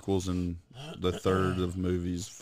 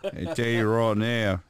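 hammed-up Aussie accent, laughing a little; mock Australian accent. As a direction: play the Australian royal, amused. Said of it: "I'm the Australian guy that loves it".

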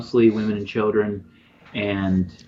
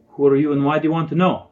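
put-on higher, quick, challenging old-woman voice; older woman; 5 semitones higher, 4 dB louder, faster than usual. Older villager challenging a stranger, quick higher voice. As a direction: question the stranger briskly in a raised pitch. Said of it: "An older woman steps out of the crowd".

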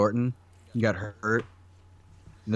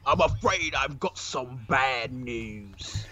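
put-on slow, drawn-out British accent of a wrestler; Bad News Barrett; 3 semitones higher, much slower than usual. Co-host shows off his wrestler impression in a British accent. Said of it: "I've been working on my Barrett".

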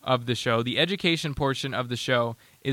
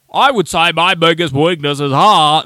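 slow, loud, stiff mock candidate; mocking his answer; 2 semitones higher, 9 dB louder than usual. Host mocks the TikTok man's answer, slow and loud. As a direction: ham up the earnest recital. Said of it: "as opposed to his answer".

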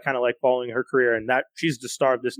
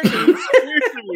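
laughing; 10 semitones higher, 4 dB louder, slower than usual. Real, not acted. Laughing exasperation.

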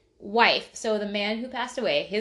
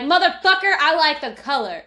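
much higher and louder angry shout; mock angry yell; 7 semitones higher, 8 dB louder than usual. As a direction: hurl it at them like a cussing outburst. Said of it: "Was like".

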